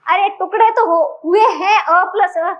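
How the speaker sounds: ordinary talk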